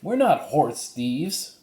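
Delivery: a hint of young Annie insisting; voicing Annie. Annie's voice in read-aloud story.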